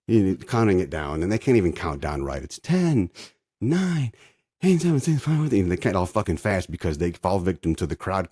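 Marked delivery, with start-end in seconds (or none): 2.4-5.6 high, loud mocking countdown. Mocking the hosts counting down the tree lighting. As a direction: count it down like a sarcastic host.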